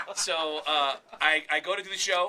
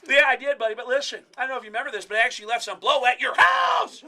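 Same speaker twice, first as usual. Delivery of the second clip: loud, raised-pitch comedian impression; Sam Kinison; 6 semitones higher, 9 dB louder than usual. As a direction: build from a forceful voice toward a shout. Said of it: "Sam Kinison is here".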